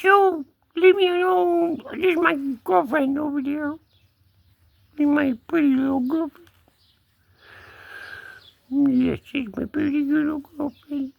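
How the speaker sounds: high-pitched put-on kitty talk; cat voice. Cat introducing his girlfriend, high cat voice. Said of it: "I like to talk for him".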